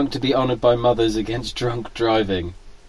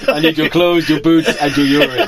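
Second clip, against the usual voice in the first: laughing; 4 semitones higher, 6 dB louder than usual. Pretend. Terminator-style quote while laughing.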